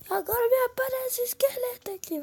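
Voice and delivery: high, loud startled cry; startled shout. Real, not acted. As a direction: yelp it at the sudden skeleton. Skeleton appears suddenly.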